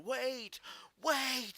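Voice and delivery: high-pitched mock yell, not full force; retold scream. Storyteller screaming back at the police who let him go, retold scream. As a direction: call out urgently, pitched well up. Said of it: "I just screamed back at them".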